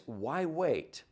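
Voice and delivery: ordinary talk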